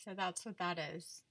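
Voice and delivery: talking around a retainer; wearing a retainer. Real, not acted. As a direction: speak with a retainer in. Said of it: "I have my retainer in".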